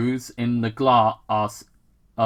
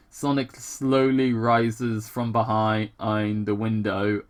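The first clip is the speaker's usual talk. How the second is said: normal talking voice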